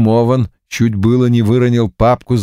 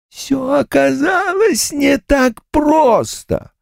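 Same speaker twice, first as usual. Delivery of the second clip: ghost voice; slower than usual. Acted.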